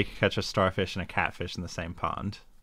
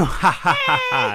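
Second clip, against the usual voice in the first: louder, higher teasing laugh; playful laugh; 10 semitones higher, 7 dB louder, slower than usual. Pretend. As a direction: laugh at the scared friend. Laughs after scaring.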